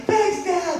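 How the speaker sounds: put-on very high child's voice; high kid thank-you; grateful little boy. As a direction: pipe it up like a relieved small son. Small son grateful.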